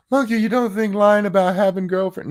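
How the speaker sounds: high-pitched mock gasp of outrage from a critic; mock outraged critic.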